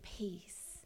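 hushed, very soft blessing; whispered prayer. Retold or imitated. Hand on his back praying for healing, whispered.

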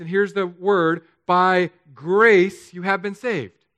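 slow, emphatic reading building in volume; scripture reading, preached. Acted. Pastor reads the forgotten verse to congregation, building declamation. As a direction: declaim the verse slowly, building to a loud finish.

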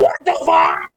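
deep growled quote of the sketch; low, gravelly sketch-comedy yell; Tim Robinson impression. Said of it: "That's a reference to a skit".

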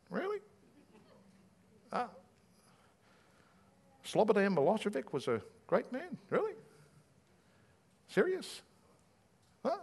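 high, soft disbelieving asides; mock the eulogy with quiet disbelief; soft sceptical murmurs at a funeral; incredulous. Mourners doubting funeral praise.

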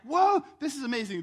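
loud, high amazed gasp of a crowd. Retold or imitated. Crowd marveling at Jesus, loud and thrilled. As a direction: wow them like a thrilled crowd.

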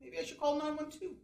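ordinary talk

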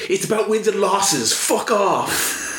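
high-pitched mock retort; imitating a wrestler. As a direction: quote the wrestler's line high and hammed-up. Acting out the wrestler's line.

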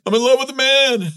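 high, loud, hammed-up bawling; mock tearful wail. Husband just home confessing to his wife, mock tearful wail. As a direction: imitate yourself blurting it out in tears. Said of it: "I am crying all the way home".

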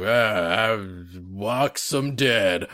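slow, rough villain growl; gruff voice. Retold or imitated. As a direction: drag it out rough and menacing. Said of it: "like, this gruff voice".

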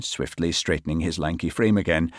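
ordinary delivery

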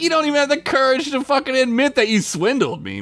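high-pitched, quick accusation; mock indignation. Hancock accusing Quark of swindling him, mock indignant. Acted accusation from retold episode.

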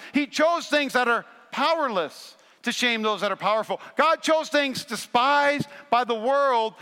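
loud, high, building preacher's cry; preaching at full force. Acted. Pastor preaches to the congregation, full-force rising sermon cry.

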